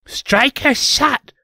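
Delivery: very high, slow attack shout; high battle cry. Acted. Diana casting a spell.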